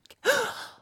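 sudden high gasp of pleasure; sharp gasp. Acted. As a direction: gasp sharply, caught off guard. Sudden pleasure mid roleplay.

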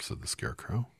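ordinary delivery

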